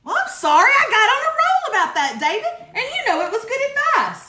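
bubbling over after getting on a roll; excited apology. Real, not acted. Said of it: "couple people having to jump off".

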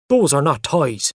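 mildly cross father. A narrator's reading. Father shocked at Mother's word, mildly cross. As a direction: correct them with a touch of annoyance. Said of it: "he said crossly".

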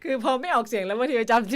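real giggles breaking into the words; laughing explanation. Real, not acted. Host admits she can't read her ID number aloud, laughing.

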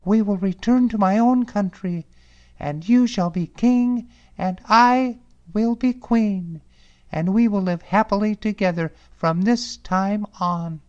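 high, bright fairy-tale princess; cartoon princess. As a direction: announce it like a storybook princess. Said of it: "said the princess".

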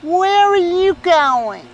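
high, drawn-out imitation of a wife. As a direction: stretch it out high, like a disapproving wife. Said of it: "and then his wife says".

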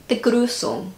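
Begrüßung aiming for a rounded German ü; attempted German pronunciation. Shown as a demonstration. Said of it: "that was a little bit better".

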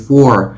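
"for" said in full, f plus a clear or; full strong "for" like the number four. Shown as a demonstration. Teacher demonstrating the full form: "for" said in full. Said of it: "phát âm đầy đủ là for".

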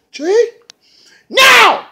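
very high, loud demand; shrill mock yell. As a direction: shriek the order. [name] yells for Jade.

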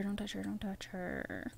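repeated plea sinking to a hush; fading plea. Real, not acted. Player telling character not to touch her, fading to hushed.

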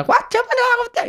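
very high squeaky gibberish voice; mock Korean babble. Narrator imitates the Korean mother's reply in a very high squeal. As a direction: parrot foreign chatter in a tiny squeal.

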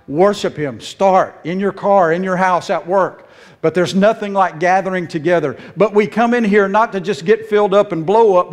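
normal talking voice

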